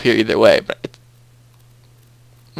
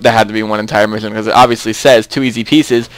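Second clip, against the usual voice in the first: ordinary delivery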